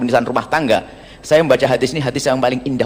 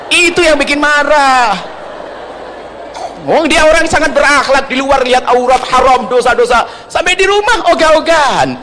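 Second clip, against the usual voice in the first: whiny frustrated husband; 11 semitones higher than usual. Retold or imitated. Preacher mocks a frustrated husband, high whining half-sob. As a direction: whine it like a fed-up husband near tears.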